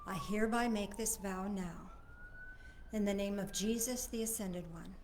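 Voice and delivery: hushed, fading prayer voice. Acted. A soft prayer to close the vow. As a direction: pray it softly, letting it fade.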